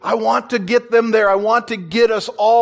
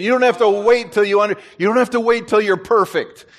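usual speaking voice

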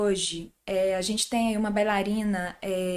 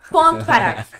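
emphatic, louder dictated full stop; dictating; 6 semitones higher, 11 dB louder, faster than usual. Real, not acted. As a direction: say it like closing the case. Echoes partner, ending the topic.

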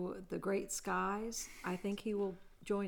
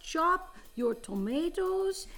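put-on Italian accent; 4 semitones higher than usual. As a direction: preach about sauce like an excitable Italian cook. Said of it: "game of accent improv".

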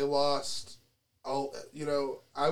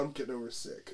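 normal talking voice

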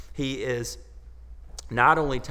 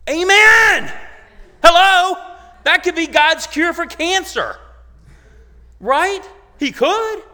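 a huge, much higher shout of Amen; preaching shout; 15 dB louder than usual. Real, not acted. Pastor rousing the congregation after the story, a full-force shout. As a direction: call it out to the whole room at full voice.

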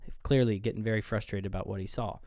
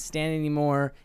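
ordinary talk

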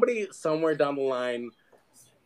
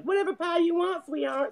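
high, syrupy waitress voice, stretched up; sweet diner waitress; 14 semitones higher than usual. Retold or imitated. High sugary waitress voice offering any pie. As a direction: play a doting Southern diner waitress calling him sweetheart.

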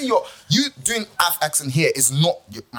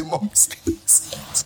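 whispered plea; 2 semitones higher than usual. Retold or imitated. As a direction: whisper it like a pleading child. Retold grandparent pleading line.

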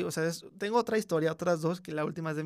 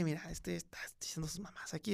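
urgent whisper to mom; secretive whisper; 8 dB quieter than usual. Retold or imitated. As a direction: whisper so the kid won't hear. Telling mom secretly about kid.

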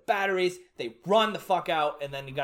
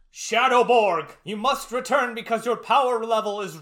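raised, louder voice of urgent warning; mock dramatic command; 4 semitones higher, 5 dB louder than usual. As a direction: call him back with hammed-up urgency. Acts out villain's urgent command.

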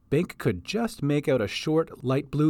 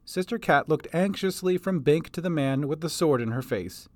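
plain reading voice

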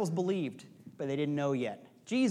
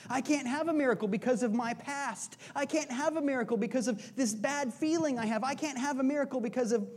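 higher-pitched, repetitive excuse-making; mock complaining; 7 semitones higher than usual. Preacher voices someone refusing a miracle, mock complaining. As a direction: imitate someone stuck in self-pity, pitched up. Acting out self-pitying excuses.

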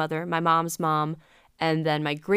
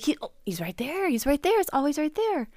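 imitated childhood excitement; 9 semitones higher than usual. Imitating childhood self pointing out moon.

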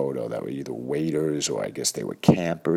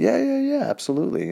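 eager yes; 3 dB louder, faster than usual. Retold or imitated. Eager answer to camp owner.

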